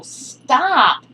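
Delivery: high, loud sudden command; sharp shout at dog. Real, not acted. Scolding her dog mid-lesson, loud shout. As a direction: snap the dog off the table. Dog gets into ritual bowl.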